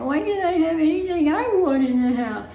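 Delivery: whiny voice. Retold or imitated. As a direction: whine it like a grumpy husband. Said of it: "he talks in a whiny voice".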